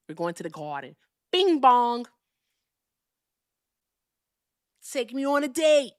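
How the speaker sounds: hammed-up Brooklyn catchphrases; Brooklyn Italian. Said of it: "I'm a Brooklyn Italian".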